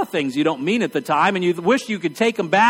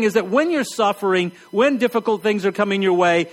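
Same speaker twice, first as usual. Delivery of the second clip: ordinary talk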